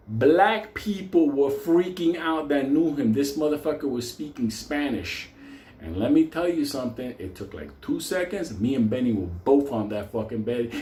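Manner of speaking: ordinary talk, nothing marked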